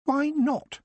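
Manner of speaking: put-on high, curious boy's question; puzzled boy pushing back; Geoffrey asking. Boy questioning the old man, high and curious. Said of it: "asked Geoffrey".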